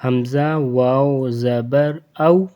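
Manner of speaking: measured, drawn-out recitation of each letter; gentle spelling drill. Shown as a demonstration. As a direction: spell it out smoothly, easing into "او". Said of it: "نرمی سے، آرام سے پڑھنا ہے".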